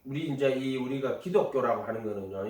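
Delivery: ordinary delivery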